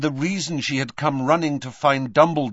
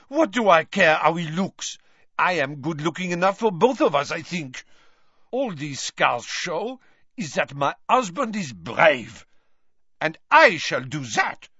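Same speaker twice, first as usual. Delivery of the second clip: put-on fierce French accent; 7 semitones higher than usual. A narrator's reading. Fleur to Mrs Weasley, fierce French accent. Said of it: "she added fiercely".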